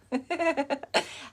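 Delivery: high bright burst of laughter. Real, not acted. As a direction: crack up at the punchline. Laughs at grandma's answer.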